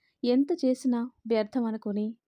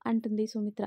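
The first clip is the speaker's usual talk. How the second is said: plain reading voice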